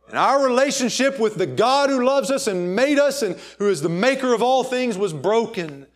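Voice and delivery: loud, high preacher's cry; fervent pulpit proclamation; preaching at full force. Acted. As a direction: lift the congregation with it. Preacher builds to a peak.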